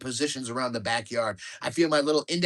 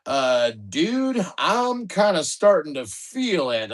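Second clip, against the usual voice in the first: drugged drawl; 3 semitones higher, 5 dB louder, slower than usual. Acted. Morphine kicking in.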